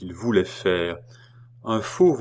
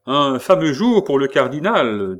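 voiced clerk; 6 semitones higher, 7 dB louder than usual. Clerk calls out on the quay.

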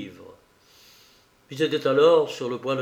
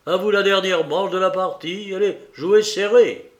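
raised, higher-pitched challenge; cri; 6 semitones higher, 4 dB louder than usual. A narrator's reading. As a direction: call out the challenge with energy. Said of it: "cria-t-il à Obenreizer". (lancer un défi d'une voix forte)

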